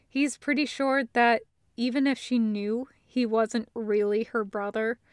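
ordinary delivery

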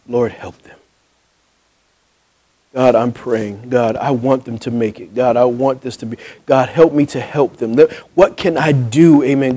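softly voiced prayer, pleading under the breath; a soft, earnest plea to God; quiet earnest prayer. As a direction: pray it softly for them, humbled. Praying for the couple instead.

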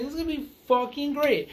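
high, fast, excited gush. Retold or imitated. Fan imitating own reaction to the trailer, excited and fast.

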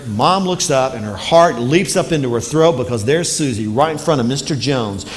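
ordinary talk, nothing marked

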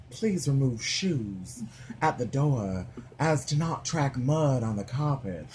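slow Southern lady reading a note aloud; Southern lady realtor. Retold or imitated. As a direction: imitate a realtor's Southern accent. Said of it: "read it in her voice out loud".

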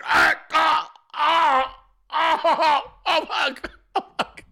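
high hammed-up cries of pain, fading; mock pained groans. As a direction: cry out as if the back just snapped. Father's back gives out stepping.

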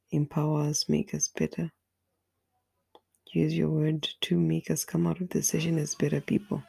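ordinary delivery

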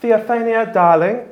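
sing-song call up the stairs; lilting, affectionate call for breakfast; mother calling down. Acted. Mother calling her daughter down to breakfast, lilting and high. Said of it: "you hear a voice call out to you that says".

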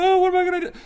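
high, whiny mock panic; mock whimper far up high; mocking whine. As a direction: mimic a whiner in a squeaky wail. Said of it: "now you're going".

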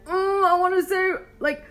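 high, drawn-out imitation of someone bursting to speak; mock desperate urge. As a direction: tease them with an exaggerated, slow, yearning wail. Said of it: "you're gonna be like".

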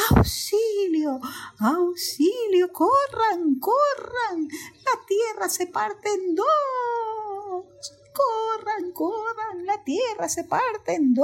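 high, drawn-out calls for help; panicked monkey cry. Acted. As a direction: cry the alarm, stretching each word. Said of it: "y empezó a gritar". (mono gritando auxilio, agudo y alargado)

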